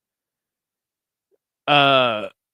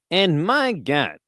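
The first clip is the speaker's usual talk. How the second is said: slow sportscaster exclamation; drawn-out, swooping ringside astonishment; Howard Cosell; slower than usual. Acted. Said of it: "Impression of Howard Cosell commentating".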